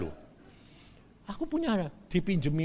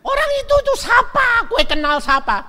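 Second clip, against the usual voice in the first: startled husband; 11 dB louder than usual. Retold or imitated. Husband confronts shouting wife.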